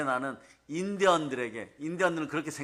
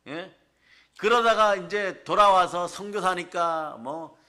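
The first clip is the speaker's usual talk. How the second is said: ordinary delivery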